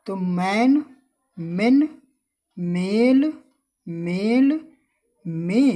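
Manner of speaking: मैन, मेन, मेल, मे said loud and each spaced out; teacher drilling words. Shown as a demonstration. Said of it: "अब इसकी भी sound लंबी".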